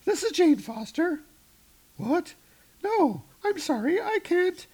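high falsetto Jane on the phone; hammed-up high Jane voice; Jane's voice. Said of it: "Jane picks it up".

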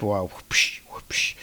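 breathy whipping whoosh; soft, unvoiced whip sound effect; whip crack whoosh. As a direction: imitate a whip lash in a whisper. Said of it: "we hear the sounds of a whip".

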